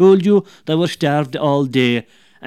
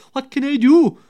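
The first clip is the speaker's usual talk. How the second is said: much higher, pleading woman's voice; worried wife; 10 semitones higher, 8 dB louder than usual. Acted. Wife pleading with the neighbour, high and anxious.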